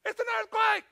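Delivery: high alarmed shout of the word; shouting earthquake. Retold or imitated. Wife jolted awake in bed, shouting in alarm. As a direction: yell it out in sudden alarm. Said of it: "she goes".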